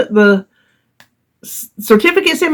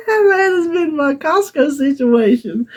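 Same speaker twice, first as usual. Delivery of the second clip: softer, laughing through the words; laughing wrap-up; 6 semitones higher, 3 dB quieter than usual. Real, not acted. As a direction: let the laugh break the sentence. Laughing at own story.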